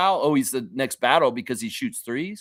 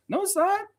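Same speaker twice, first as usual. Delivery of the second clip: higher mock denial. Real, not acted. Repeating the denial even higher.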